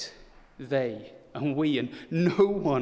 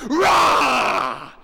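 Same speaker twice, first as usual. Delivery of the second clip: huge shouted rah, much higher and louder; too-loud monster roar; 11 semitones higher, 12 dB louder than usual. Retold or imitated. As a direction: roar full force to scare the room. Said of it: "But this is too loud".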